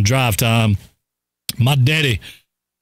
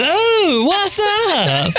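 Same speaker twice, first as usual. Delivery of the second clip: higher, put-on voice of a woman; high mock woman voice; much slower than usual. Imitating her asking her son what's up. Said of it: "She said".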